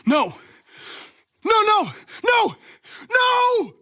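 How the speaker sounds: high, loud cries of denial; anguished shouts of no. Acted. Android who has failed, crying out in loud despair. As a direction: scream it out as everything collapses. Android refuses to accept failure.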